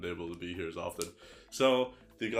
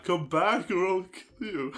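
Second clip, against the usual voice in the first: mock menacing threat; 10 semitones higher, 6 dB louder than usual.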